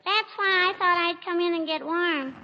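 put-on high, sweet child's voice, sing-song; little girl voice. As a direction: play a cheeky little girl. [name] plays little girl Teeny.

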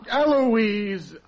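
high, drawn-out exclamation of reproach; exasperated cry. Acted. Husband reproaches his wife for breaking secrecy, exasperated cry.

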